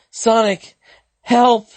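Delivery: high, loud cry for help; desperate scream for help. Acted.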